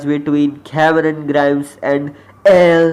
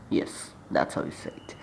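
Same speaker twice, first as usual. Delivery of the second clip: Irish accent; 11 semitones lower, 15 dB quieter than usual. Shown as a demonstration. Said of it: "let's stop teaching Irish accent".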